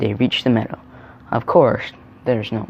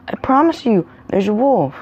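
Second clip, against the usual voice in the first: high-pitched, urgent insistence; desperate pleading; 9 semitones higher, 3 dB louder than usual. A narrator's reading. Terrified boy insisting he is truthful.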